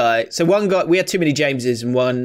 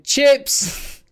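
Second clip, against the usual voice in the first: mocking shout; 11 semitones higher than usual. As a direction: shout it across the canteen to mock him. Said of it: "One older bully type lad from another school shouted".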